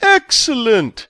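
high, loud, drawn-out cheer; giddy delight. A narrator's reading. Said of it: "clapped his hands like a giddy schoolboy".

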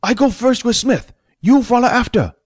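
very high put-on character voice; high-voiced character barking orders; shouted orders. A narrator's reading. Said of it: "Fat shouted".

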